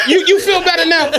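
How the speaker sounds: ordinary talk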